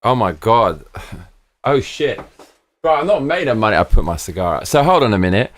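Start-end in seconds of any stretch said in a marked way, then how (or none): 1.7-3.8 exasperated protest. Real, not acted. Chat suggests an expensive Japan trip.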